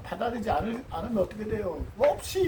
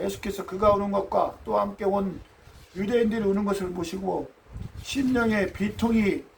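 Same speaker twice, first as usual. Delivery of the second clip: scripture reading. Acted. Reads scripture aloud.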